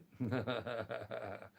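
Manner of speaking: soft rippling laugh; amused chuckle. Real, not acted. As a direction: chuckle softly at yourself. Laughs about having a phone.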